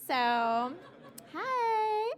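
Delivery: Kardashian voice. Shown as a demonstration. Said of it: "I do speak fluent Kardashian".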